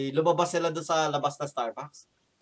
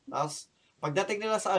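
ordinary talk

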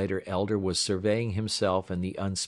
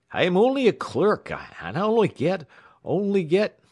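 much higher frightened plea; panicky pleading; 12 semitones higher, 6 dB louder than usual. A narrator's reading. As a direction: stammer it out in high panic. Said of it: "he protested".

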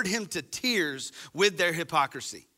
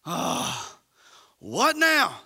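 rising, louder put-upon reply; exasperated what now; 4 semitones higher, 3 dB louder, much slower than usual. Retold or imitated. People called out by God, answering exasperated. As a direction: sigh then snap back. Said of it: "called out by God".

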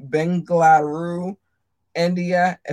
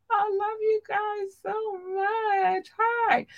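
squeaky cute voice; much slower than usual. Acted. Cutesy thanks to listeners.

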